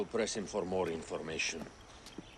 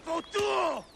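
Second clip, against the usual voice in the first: high, loud screaming defiance; desperate scream; 4 dB louder than usual. Acted. Captive screaming at his captors to kill him. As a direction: scream at them to just end it.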